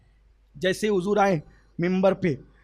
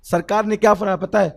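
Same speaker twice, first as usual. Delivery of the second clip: ordinary delivery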